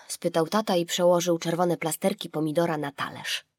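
ordinary delivery, nothing marked

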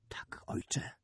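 whispered, submissive reply; barely voiced, very soft; said quietly. A narrator's reading. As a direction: murmur it in a near-silent whisper. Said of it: "rzekł cicho Perilavos".

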